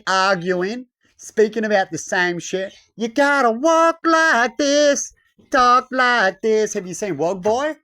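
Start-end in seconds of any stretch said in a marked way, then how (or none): 2.9-6.7 high, loud, drawn-out parody; mock bossy leader. Leaders telling people how to act, mock bossy voice. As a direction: stretch each command out in a mocking voice. Mimics arguing leaders giving rules again.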